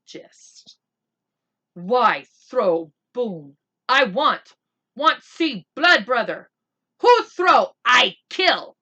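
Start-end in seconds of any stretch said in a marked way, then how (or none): 1.7-8.8 loud, slow warrior's roar. Acted. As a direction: bellow the threat, higher and louder. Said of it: "he roared".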